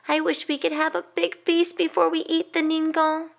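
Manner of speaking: put-on high, wishful child's voice; hungry boy. Middle boy wishing for a feast, high and longing. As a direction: wish aloud like a longing boy.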